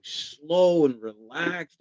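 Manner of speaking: drawn-out words, very slow. Retold or imitated. Describing the laid-back West Coast, said very slowly.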